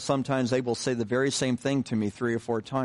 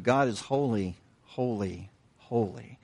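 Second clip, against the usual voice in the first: each "holy" set apart by a pause; "holy, holy, holy" spaced out, dropping softer after the first; weighty repetition; 3 semitones lower, 3 dB quieter than usual. Shown as a demonstration. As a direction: let every "holy" land alone, hushed and weighty. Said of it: "The emphasis of the word adds greater weight".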